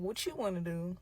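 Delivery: soft, understated imitation of him; quiet deflecting question. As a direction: hand the question over quietly. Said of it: "He like".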